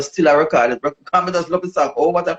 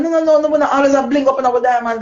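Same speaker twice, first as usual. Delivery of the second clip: higher, quick protesting no no no; sister protesting; 10 semitones higher than usual. Retold or imitated. Imitating her sister objecting, higher pitched. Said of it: "And she said".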